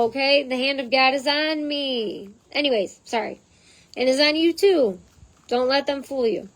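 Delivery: normal talking voice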